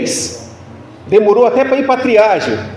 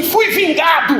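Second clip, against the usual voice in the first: gleeful cry; 7 semitones higher, slower than usual. Acted. Triumphant inner thought.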